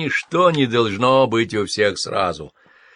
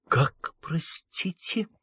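slow, unvoiced whisper; stunned whisper; 8 semitones higher, 5 dB quieter, slower than usual. A narrator's reading. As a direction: breathe it out in shock. (ошеломлённый шёпот)

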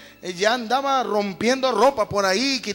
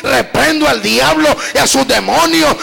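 much louder, shouting rebuke of the devil; fiery preaching; 2 semitones higher, 8 dB louder than usual. Real, not acted. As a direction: rebuke Satan at full force. Predicación a todo pulmón. (predicación gritada contra el diablo)

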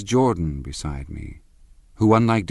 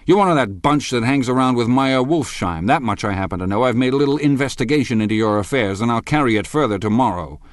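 raised and driving, a quick threat; heated accusation; 5 semitones higher, 4 dB louder, faster than usual. Acted. Husband threatening rival, raised and heated. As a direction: lay out the charges hard.

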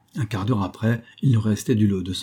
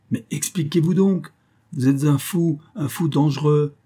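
higher-pitched, agitated pleading; mildly panicked, voice pitched up; alarmed protest; 6 semitones higher than usual. A narrator's reading. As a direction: protest as if facing a madman. Threatened man protests to armed accuser.

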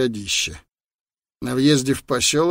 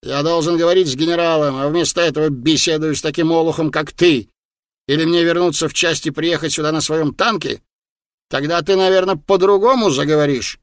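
angry yelling; 3 semitones higher, 3 dB louder than usual. A narrator's reading. Officer berating a sentry who blocks his way, angry. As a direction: berate the sentry with raised, insistent voice. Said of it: "он заорал на часового".

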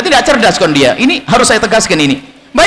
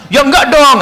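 higher, lightly voiced friend's reply; quick acted denial, pitched up; friend's reassurance; 6 semitones higher, slower than usual. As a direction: answer as the easygoing friend, pitch lifted. Friend answers in acted dialogue.

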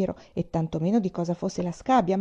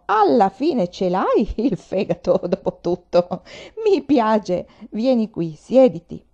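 a lightly voiced old man, louder, booming approval; hearty gruff delight; 5 dB louder, slower than usual. A narrator's reading. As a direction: boom with amused admiration. Old man laughs, admires her. (vecchio burbero divertito, voce appena caratterizzata)

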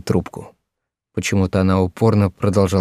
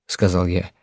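plain reading voice

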